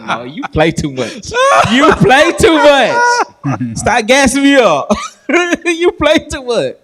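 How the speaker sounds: high, loud, laughing shout; laughing protest. Retold or imitated. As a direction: cry out in laughing disbelief at the praise. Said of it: "I said".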